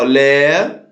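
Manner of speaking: "lé" held long, low and rising; teacher slowly sounding out "lé" alone; isolated syllable. Shown as a demonstration. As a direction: stretch "lé" out from a low start. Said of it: "que eu ia separar".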